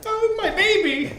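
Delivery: high-pitched wail for a beloved thing; anguished cry. Acted. Ben sees his saw kicked away.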